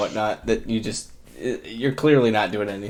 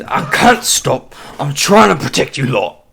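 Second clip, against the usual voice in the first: strained goon; 3 semitones higher, 7 dB louder than usual. Acted. Goon refusing to stop for the party. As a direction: plead while struggling.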